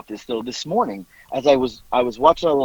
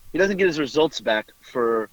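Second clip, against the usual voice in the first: ordinary delivery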